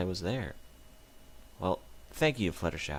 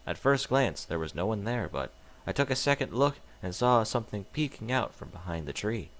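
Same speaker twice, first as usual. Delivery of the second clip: ordinary delivery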